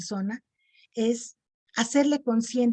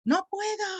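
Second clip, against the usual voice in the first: much higher call into the cave; 10 semitones higher than usual. Shown as a demonstration. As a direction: cry out no puedo to the echo. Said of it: "Y si yo envío".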